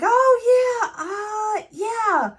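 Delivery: loud, high, exaggerated oh-yeah stall; mock covering up. Deacon takes the hint, hammed-up cover-up. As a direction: stall loudly after catching the hint.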